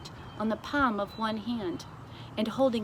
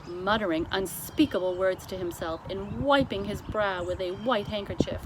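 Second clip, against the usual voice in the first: usual speaking voice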